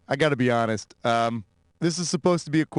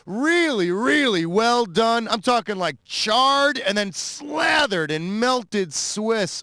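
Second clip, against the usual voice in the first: drooling over burgers; 4 semitones higher, slower than usual. Acted. Host raves about charred burgers.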